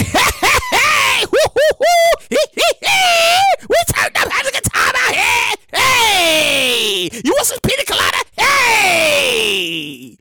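mocking Florida partiers; partying Florida crowd. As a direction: hoot like a rowdy street party. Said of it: "they in Florida going".

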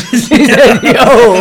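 laughing hard while quoting; cracking up mid-line. Real, not acted. Laughing while quoting his friend's greeting. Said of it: "What the fuck? Who says that?".

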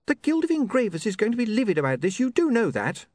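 put-on high, sharp young woman's voice; an offended, prim young lady; indignant young woman. Sacharissa demands.

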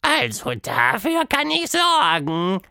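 high, slow, sing-song monster voice; fluting monster. A narrator's reading. Quatschmonster promising Tilda help, high fluting voice. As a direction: pipe it up like a cheerful little monster. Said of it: "flötete Quatschmonster".

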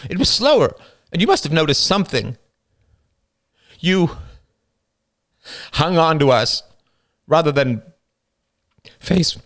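a worn-out mother defending herself; mother Christine. Acted. Christine answers her son.

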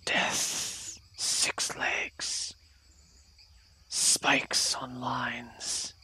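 breathless whisper, slow and secretive. Acted. Hidden creature speaks to traveler.

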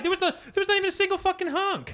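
very high, loud outraged exclaim; indignant. Real, not acted. Telling the listeners nobody honked, outraged. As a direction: protest in disbelief, pitching way up.

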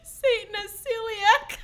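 high, slow, laughing hard; squeezed out through laughter. Real, not acted. Both in helpless laughter.

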